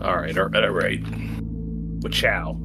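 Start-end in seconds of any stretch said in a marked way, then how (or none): none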